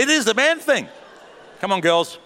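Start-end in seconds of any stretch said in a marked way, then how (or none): 0.0-0.9 mock emphatic agreement. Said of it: "I'd say".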